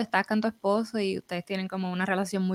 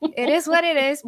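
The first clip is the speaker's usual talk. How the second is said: weary mock agreement; 8 semitones higher, 4 dB louder than usual. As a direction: sigh along with the cliché. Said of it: "uno se queda". (resignación burlona y aguda)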